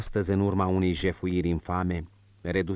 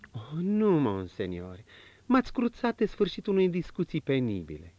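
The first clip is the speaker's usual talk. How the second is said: higher, lighter woman's voice, confiding; marchioness's voice; 8 semitones higher than usual. Acted. Marchioness confiding to the prince, in a higher woman's voice.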